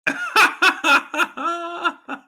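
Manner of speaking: high-pitched cackle; laughing. Real, not acted. Laughing at his own mockery.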